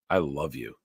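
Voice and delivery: normal talking voice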